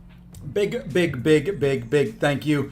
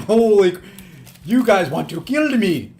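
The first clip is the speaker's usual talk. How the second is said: surprised; 6 semitones higher, 4 dB louder, slower than usual. Real, not acted. Huge donation surprise.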